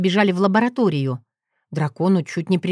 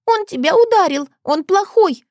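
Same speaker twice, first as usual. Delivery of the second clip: put-on very high little girl's protest; angry child; 12 semitones higher, 4 dB louder than usual. A narrator's reading. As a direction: object crossly like a small child. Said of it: "сердито противилась она". (сердитый детский голос)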